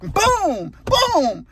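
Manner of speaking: two high, far-off booms, each falling off; mock crash booms. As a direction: act out the crash with a high boom, boom. Retelling the truck hitting hog.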